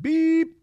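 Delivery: very high, held bleep sound; censor beep. Pretend. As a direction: imitate a bleep over a swear word. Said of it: "B word".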